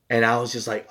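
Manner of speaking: ordinary delivery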